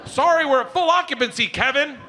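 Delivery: high, loud cackly voice; imitated cackling wife. Wife joking to dealer on the phone, cackling. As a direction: mimic a wife cackling through her words. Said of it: "My wife was cackling".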